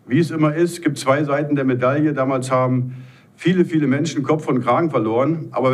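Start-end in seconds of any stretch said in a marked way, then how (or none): none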